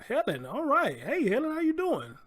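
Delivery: high, delighted squeal of a greeting; excited. Real, not acted. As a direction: sing out the hello, thrilled.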